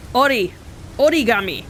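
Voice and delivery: origami said the Japanese way, "odigami"; Japanese pronunciation. Shown as a demonstration. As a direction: origami with a light tapped r, o-ri-ga-mi. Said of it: "That's how the Japanese pronounce it".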